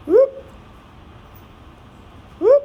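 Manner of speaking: a sudden high, loud whoop of delight; excited whoop. Real, not acted. Teacher whooping at the tape trick for the class, excited. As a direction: cheer the tape trick with a squeal.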